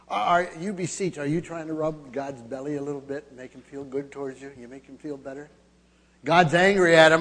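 usual speaking voice

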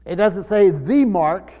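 slow and loud, each word weighted; emphatic slow point. Real, not acted. Pastor stressing the text's wording, slow and loud. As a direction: hammer home that the article is missing.

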